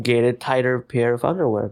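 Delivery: ordinary delivery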